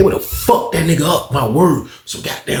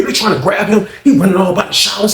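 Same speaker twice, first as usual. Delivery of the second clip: ordinary talk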